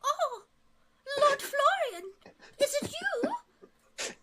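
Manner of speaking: high, slow, breathless lady voice; a gasping noblewoman spotting her lost love; surprised lady. Acted.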